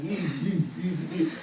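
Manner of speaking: mock ape grunts, short repeated "ee"; imitated gorilla noises. Mocking rappers who walk like gorillas, imitated ape noises. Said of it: "walking like gorillas on the".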